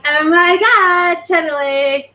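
put-on slow, high Valley girl drawl. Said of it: "I actually do a really good Valley Girl".

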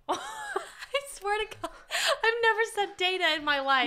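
laughing through the words; giggling helplessly as she speaks; cracking up mid-sentence. Real, not acted. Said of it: "laughing while talking".

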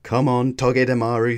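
usual speaking voice

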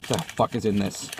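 ordinary talk, nothing marked

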